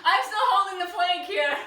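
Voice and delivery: laughing through the words. Real, not acted. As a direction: laugh while you say it. Laughing while holding a plank.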